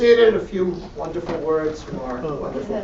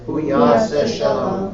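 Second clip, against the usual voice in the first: prayer; 3 semitones lower, 4 dB louder than usual. Acted. Leads Mourner's Kaddish.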